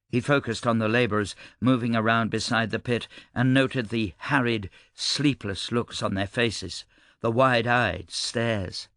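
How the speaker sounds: usual speaking voice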